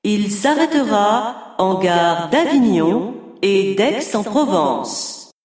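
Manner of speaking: formal public address announcement; train station announcement. Acted. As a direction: read it like a station loudspeaker. Train platform announcement in exam.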